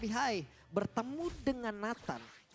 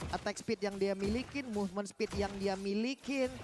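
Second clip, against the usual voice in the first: normal talking voice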